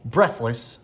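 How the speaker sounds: ordinary delivery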